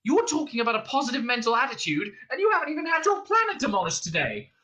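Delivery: high, agitated rant; Arthur complaining. A narrator's reading. Man whose planet was demolished, complaining hysterically. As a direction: rant on with mounting outrage, pitch climbing. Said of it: "complained Arthur".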